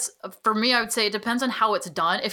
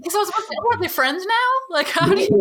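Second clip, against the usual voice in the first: incredulous; 10 semitones higher, 5 dB louder than usual. Real, not acted. Incredulous laughing question.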